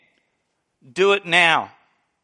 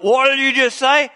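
menacing challenge; 6 semitones higher, 3 dB louder than usual. Retold or imitated. Big man confronting them.

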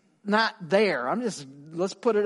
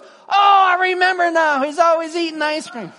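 much higher, loud shout of recognition; joyful shout; 11 semitones higher, 9 dB louder than usual. Retold or imitated. Old friend finally remembering the speaker, loud joyful shout. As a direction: cry it out as the memory hits.

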